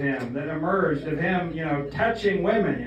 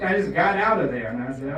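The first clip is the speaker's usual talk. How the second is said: usual speaking voice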